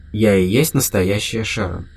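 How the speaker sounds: plain reading voice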